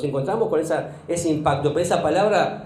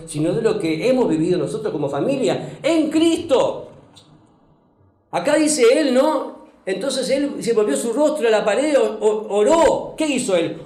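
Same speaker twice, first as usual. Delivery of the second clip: fervent preaching; 4 semitones higher than usual. Acted. Pastor urging the church to pray in hard times, fervent. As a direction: drive it home to the congregation. Preaching builds to full force.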